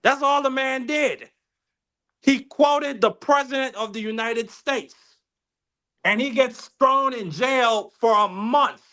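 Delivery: high-pitched, incredulous outrage; worked up and appalled, pitch held high; indignant. Real, not acted. Indignant at man jailed for quote.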